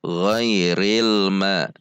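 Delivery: "ghairil ma" slow, connected recitation; practice reading. Shown as a demonstration. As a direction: recite "ghairil ma" slowly, l flowing into ma. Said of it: "melatihnya dengan membaca". ("ghairil ma" dibaca pelan dan bersambung)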